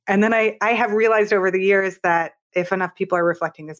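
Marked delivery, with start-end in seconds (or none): none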